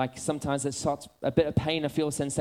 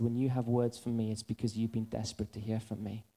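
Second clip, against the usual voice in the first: lower, softer, firm but patient; a hint of a calm divine voice, lower and softer; gentle voice of God; 5 semitones lower, 4 dB quieter than usual. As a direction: speak as God correcting him kindly. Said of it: "He said this to me".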